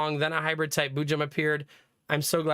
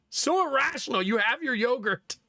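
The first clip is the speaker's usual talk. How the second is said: high, exasperated pleading; exasperated dad; 9 semitones higher than usual. Retold or imitated. Dad to kid who already has yogurt, exasperated pleading. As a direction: plead in hammed-up disbelief.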